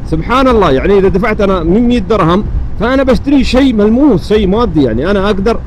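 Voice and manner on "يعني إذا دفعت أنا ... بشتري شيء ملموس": ordinary talk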